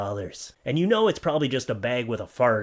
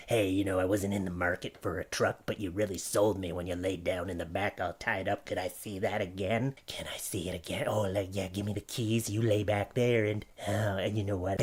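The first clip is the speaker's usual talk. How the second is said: quieter, hushed creepy buyer; creepy truck buyer; 5 semitones lower, 6 dB quieter than usual. Retold or imitated. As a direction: murmur like a creep who wants the show again. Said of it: "be like".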